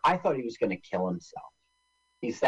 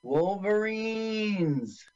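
overacted death scene; 8 semitones higher, much slower than usual. Echoing the dying cry.

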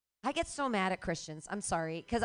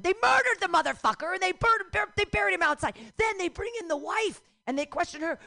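much higher, louder indignant telling; outraged retelling; 9 semitones higher, 6 dB louder than usual. Real, not acted. Comic retelling the church murder, high and outraged. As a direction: tell it with shocked outrage.

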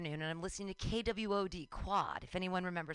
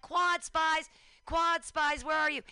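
loud, higher searching call; 5 semitones higher, 5 dB louder, slower than usual. Retold or imitated. Fan hunting radio staff in the arena, calling out. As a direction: holler it across a crowded arena.

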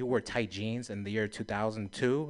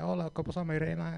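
casual Spanish greeting, a bit higher; 5 semitones higher, 4 dB quieter, faster than usual. Retold or imitated. Flirting with the register lady, light Spanish greeting. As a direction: greet her warmly in Spanish. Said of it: "I spoke a little bit Spanish".

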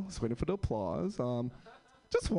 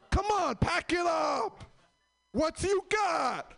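mock yell; 12 semitones higher, slower than usual. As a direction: holler like an impatient friend. Stoner friends yelling for bowl.

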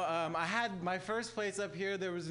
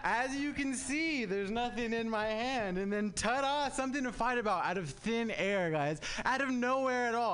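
mock magician; 7 semitones higher, 5 dB louder than usual. Comic mimicking his girlfriend as a magician pulling a fight from thin air. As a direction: present it like a stage magician's reveal. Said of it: "like I was getting in a fight with a magician".

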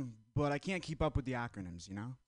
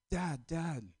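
called out for dad; 4 semitones higher than usual. Retold or imitated. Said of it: "called out for my dad".

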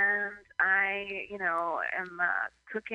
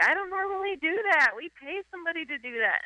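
high, entitled whine; imitated pampered wife; 9 semitones higher, faster than usual. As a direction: sniff it like a spoiled rich wife. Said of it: "she's like".

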